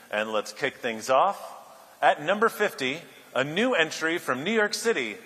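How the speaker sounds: debonair British MC. Retold or imitated. MC announcing the list to the room, debonair host voice. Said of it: "handsome, debonair British M C".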